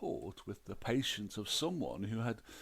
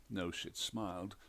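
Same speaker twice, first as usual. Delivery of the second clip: plain reading voice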